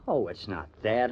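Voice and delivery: normal talking voice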